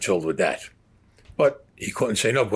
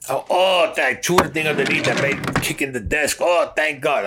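higher and louder, tumbling out in a rush of relief; flustered relief; 5 semitones higher, 3 dB louder than usual. Retold or imitated. A man relieved the gunmen are only cops, blurting in excitement. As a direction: blurt it like a man who just escaped death.

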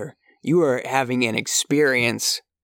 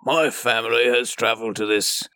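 mock-solemn traveler. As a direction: grandly declare a family quest. Acting solemn traveler on quest.

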